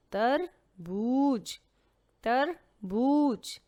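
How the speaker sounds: very slow, stretched teaching read; slow blended word; drawn-out word. Shown as a demonstration. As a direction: stretch each syllable for the class. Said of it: "तो क्या बनता है?".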